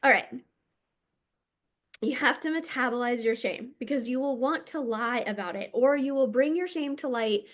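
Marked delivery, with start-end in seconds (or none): none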